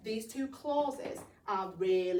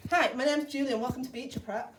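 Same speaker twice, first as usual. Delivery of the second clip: quick, louder blurt of the greeting; a nervous boy blurting his welcome, louder; blurted out quickly; 3 semitones higher, 6 dB louder than usual. Shown as a demonstration. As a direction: blurt it out under pressure. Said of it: "we've got to say this relatively quickly".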